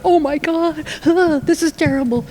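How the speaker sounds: high, mock-horrified inner reaction; panicked parent. Parent hearing child say that about drinking, mock panic. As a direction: play a parent inwardly panicking.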